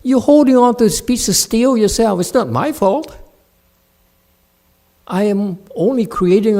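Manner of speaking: loud, high angry rebuke; furious master scolding. Real, not acted. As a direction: scold the student for blaming him. Master scolding student loudly.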